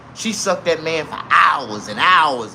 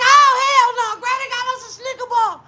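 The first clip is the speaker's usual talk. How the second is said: put-on excited kids. Grandkids thrilled at granny's Snicker bar, high squeal. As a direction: squeal like kids spotting candy.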